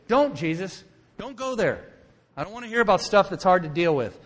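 high, forceful objection; agitated protest. Acted. Simon refusing to hear hard stuff, agitated protest. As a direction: cut him off with an urgent refusal.

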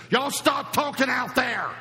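put-on sudden high loud yell at the kids; mother yelling. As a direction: shout across the room at the kids, slow and shrill. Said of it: "And then she did this".

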